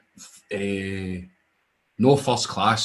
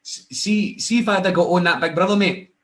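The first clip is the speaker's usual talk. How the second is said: excited; 7 semitones higher, 3 dB louder than usual. Real, not acted. Excited dream of Big Brother.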